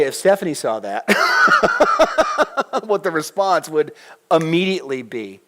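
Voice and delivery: ordinary talk, nothing marked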